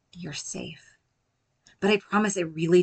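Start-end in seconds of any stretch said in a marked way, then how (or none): 0.1-1.0 "You're safe" breathed almost silently; very soft soothing murmur; gentle near-whisper. Shown as a demonstration. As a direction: hush it like a secret comfort. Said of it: "speak to ourselves gently".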